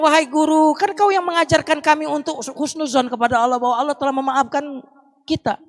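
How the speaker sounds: pleading student. Retold or imitated. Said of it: "Muridnya bilang".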